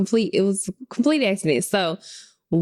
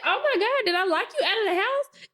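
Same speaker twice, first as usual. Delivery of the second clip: high, laughing "oh my God", then a rushed question; laughing dismay; 13 semitones higher than usual. Retold or imitated. Mom realizing she locked her son out, high and laughing. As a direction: realize the mistake mid-laugh. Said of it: "and I said".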